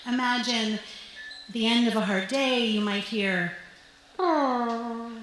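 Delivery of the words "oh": sigh-trumpet. Retold or imitated. Mom at day's end, sigh-trumpet exhale. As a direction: honk out a weary sigh. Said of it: "a cross between a sigh and a trumpet".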